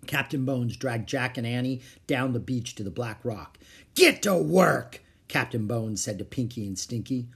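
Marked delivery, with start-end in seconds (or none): 3.9-5.0 loud, drawn-out pirate command; barked order. Acted. Captain to Pinky and Stinky's crew, slow loud command.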